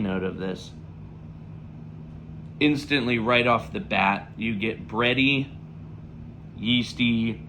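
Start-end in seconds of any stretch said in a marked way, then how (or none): none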